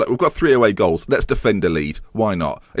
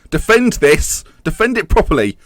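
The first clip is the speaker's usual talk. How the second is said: louder, higher orders to defend; urgent command; 7 semitones higher, 5 dB louder than usual. Real, not acted. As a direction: bark it at the defence. Urging team in last seconds.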